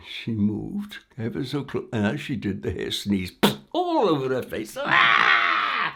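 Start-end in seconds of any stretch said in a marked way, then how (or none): 4.8-5.9 loud high yelp of disgust; startled shriek. Acted. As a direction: shriek as you get sneezed on. Hair sneezes on queen.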